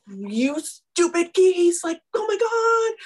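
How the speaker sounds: high, slow, loud exasperation at the geese; hammed-up high yell at geese; exasperated outburst. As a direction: blow up at the geese, half laughing. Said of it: "I'm like".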